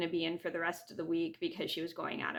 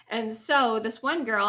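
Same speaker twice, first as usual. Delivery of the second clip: ordinary talk, nothing marked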